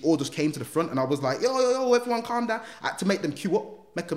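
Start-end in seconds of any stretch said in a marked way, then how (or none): 1.4-2.6 high-pitched call to a crowd; calling for calm. Retold or imitated. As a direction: retell yourself settling an excited crowd, pitched way up. Calling order to crowding pupils.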